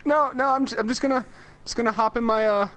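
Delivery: high, stammering British voice; flustered mock-British excuse, pitched high; fake British accent. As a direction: bluff your way out in a British accent. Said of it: "he just started talking like this".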